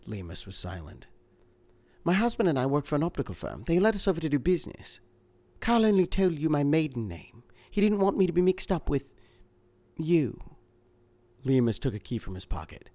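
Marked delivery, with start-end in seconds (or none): 2.0-10.6 higher, anxious woman's voice; a frightened wife, urgent. A narrator's reading. Woman character in reading.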